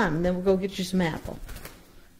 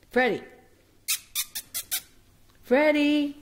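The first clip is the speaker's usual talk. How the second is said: normal talking voice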